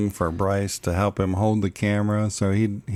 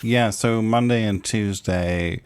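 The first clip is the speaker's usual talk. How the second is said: normal talking voice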